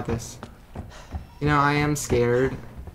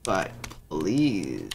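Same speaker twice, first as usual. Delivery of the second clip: villain begging; 7 semitones lower, 3 dB quieter than usual. Retold or imitated. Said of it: "And he said".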